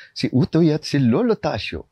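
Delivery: suspenseful horror serial narration; radio drama narrator. Acted. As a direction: narrate a spooky tale for radio.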